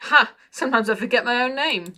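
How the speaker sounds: a nervous driver's rising, laughing wheeze; high-pitched wheeze. A narrator's reading. Nervous driver babbling to Tea Time, high wheezy voice. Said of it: "his voice was high-pitched wheeze".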